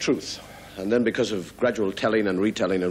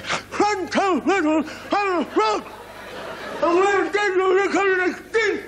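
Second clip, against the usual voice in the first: wide-mouthed frog calling. Acted. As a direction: call up to a giant from far below. Frog pleads with bird.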